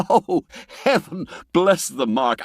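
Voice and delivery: a delighted laugh breaking into the exclamation; chuckle, then marvel at the feast. A narrator's reading. Narrator marvels at the banquet.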